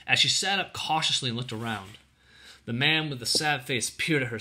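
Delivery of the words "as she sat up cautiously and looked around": plain reading voice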